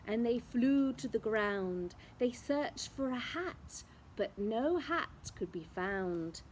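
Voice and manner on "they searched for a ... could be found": ordinary delivery, nothing marked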